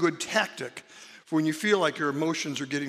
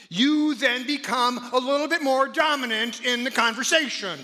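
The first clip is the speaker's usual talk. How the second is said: higher and louder, taking over the room; raised voice; 7 semitones higher, 6 dB louder than usual. Shown as a demonstration. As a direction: show how a raised voice dominates. Demonstrating a raised voice.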